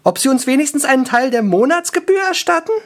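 high, fast elf whine; high-pitched, hurried complaint; fretting elf. Acted. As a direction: rattle it off high and fretful. Wailing elf goes on complaining.

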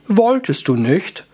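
slow, lightly affected wizard; lightly voiced, mannered wizard question.

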